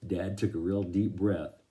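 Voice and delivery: ordinary delivery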